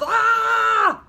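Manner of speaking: high, loud startled cry; mother bitten on the nose, crying out; yelled. A narrator's reading. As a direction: yelp out in sudden pain. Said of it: "she yelled".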